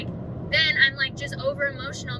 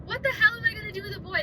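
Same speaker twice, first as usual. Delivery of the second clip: panicked disbelief; 10 semitones higher, faster than usual. Retold or imitated. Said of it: "That was my first question".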